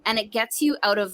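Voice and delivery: usual speaking voice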